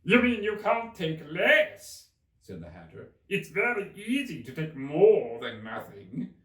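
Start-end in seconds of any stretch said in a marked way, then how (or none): 0.0-2.1 high, loud, slow mocking Hatter. 3.3-6.3 high, loud, slow mocking Hatter.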